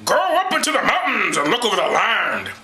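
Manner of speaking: high, put-on prophet's proclamation; hammed-up biblical leader sending out his men; Moses. Mocking Bible reader voicing orders to the spies, theatrical proclamation. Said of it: "This is my Moses voice".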